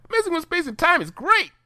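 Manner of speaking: very high, delighted pony voice; Pinkie Pie voice. Acted. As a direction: squeal it up in delight.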